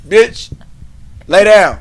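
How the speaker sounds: normal talking voice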